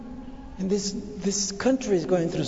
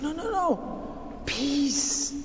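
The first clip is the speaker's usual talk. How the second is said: soft, slow, higher voice of comfort; hushed reassurance; 6 semitones higher, 8 dB quieter, slower than usual. Acted. Jesus calming afraid disciples, hushed and tender. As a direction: soothe them, sinking into a whisper.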